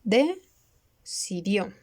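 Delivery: "decidió" drawn out slowly, split across the line break as "deci-dió"; slow split word. Shown as a demonstration. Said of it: "Acá está". ("decidió" muy lento, separado por sílabas)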